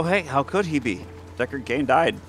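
ordinary talk, nothing marked

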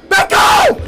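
very high-pitched yelp; panicked yell. Real, not acted. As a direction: shriek it to ward off an attacker. Fending off attacker in game.

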